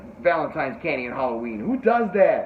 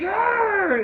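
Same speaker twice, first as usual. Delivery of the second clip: straining cry; 5 dB louder than usual. Retold or imitated. Struggling to pull hand free.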